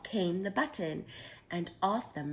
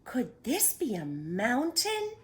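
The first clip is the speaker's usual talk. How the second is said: wondering aloud; 6 semitones higher, 4 dB louder, slower than usual. A narrator's reading. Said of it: "he wondered".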